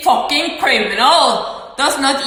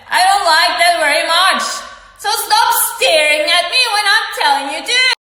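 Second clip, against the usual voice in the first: high, heated scolding that climbs; angry command; 5 semitones higher, faster than usual. Retold or imitated. Speaker orders person to stop staring, angry command. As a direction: lay down the order with rising pitch.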